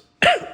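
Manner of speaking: sudden loud cough; sharp cough. Real, not acted. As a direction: cough hard, caught off guard. Powder goes down throat.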